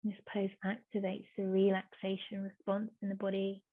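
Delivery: soft, hushed yoga instruction with long pauses; unhurried, softly spoken relaxation guidance; guided meditation. Real, not acted.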